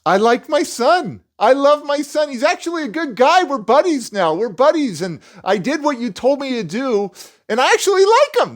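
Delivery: high-pitched, gushing retold confession; elated dad. Dad phoning to say he likes his son, elated. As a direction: build to an exclaimed peak, imitating a delighted father. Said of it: "That's what he kept saying".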